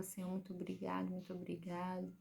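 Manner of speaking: soft, hushed prayer of thanks; tearful thanks. Retold or imitated. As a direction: murmur thanks to God, moved. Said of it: "comecei a chorar também, emocionada". (agradecimento emocionado e baixinho)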